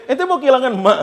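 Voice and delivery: mild mock high scold. Father scolding his son, high and mildly cross. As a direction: tell the son off. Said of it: "ngomelin anaknya".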